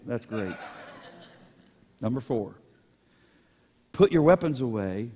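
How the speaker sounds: normal talking voice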